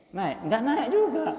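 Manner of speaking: a hint of high, slow teasing on naik; mock disbelief. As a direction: drawl the first naik up, then drop it with nggak naik juga. Preacher mocks deeds that never rise.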